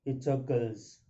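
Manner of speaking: ordinary talk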